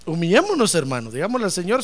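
usual speaking voice

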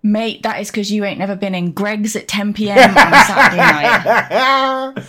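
loud, laughing through the words; laughing while teasing a friend; real laughter running through the line. Real, not acted. Laughing, joking about Greggs.

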